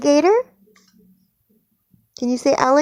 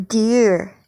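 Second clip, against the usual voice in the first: ordinary talk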